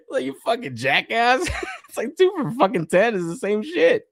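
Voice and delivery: high-pitched, laughing through the insult; mock the shopper while cracking up; laughing scold. Real, not acted.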